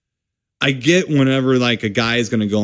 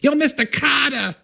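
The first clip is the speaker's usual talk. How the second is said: much higher, lightly put-on street voice; mock street greeting; 14 semitones higher than usual. Hearing the British name as a street shout-out, light put-on. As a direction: hail him like a buddy from the block. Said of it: "all I could keep hearing is".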